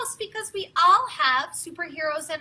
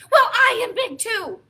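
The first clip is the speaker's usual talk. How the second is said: Gerald; 7 semitones higher, 8 dB louder than usual. Acted. Gerald standing up for Piggy.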